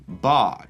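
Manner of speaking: "ba" without the R, long ah; dropped R. Shown as a demonstration. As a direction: say "ba" like southern England. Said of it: "pronounces R's like the rest of England".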